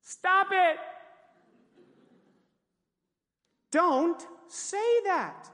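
high shout, then slow drawn-out command; emphatic rebuke. Acted. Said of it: "this loving rebuke from the Lord".

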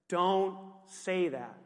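slow, level correction; deliberate rebuke. Acted. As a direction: stretch each word in calm reproof. Answering an imagined complainer with rebuke.

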